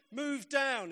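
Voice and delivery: high-pitched mock call down a train; retold shout. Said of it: "people who get on are shouting".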